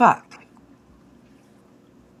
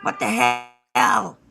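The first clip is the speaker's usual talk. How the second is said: playful pretend scolding from a pet; voicing the cat; 4 semitones higher than usual. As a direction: speak up as the cat's comic voice. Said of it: "even my cat is like".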